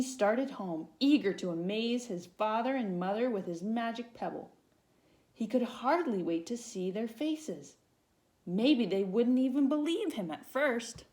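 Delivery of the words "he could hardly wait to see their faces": ordinary delivery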